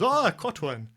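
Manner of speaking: delighted fisherman landing a fish; excited catch. Acted. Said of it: "He goes".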